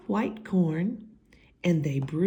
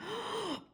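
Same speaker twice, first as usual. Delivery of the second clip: sharp gasp; 15 dB quieter than usual. Acted. Shocked gasp.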